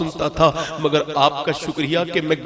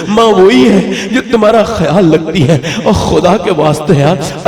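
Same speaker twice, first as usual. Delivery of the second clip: rising, fervent sermon voice building louder; impassioned preaching; 6 dB louder than usual. Acted. Preacher urging the congregation to love mothers, fervent. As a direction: plead with the crowd to honor their mothers.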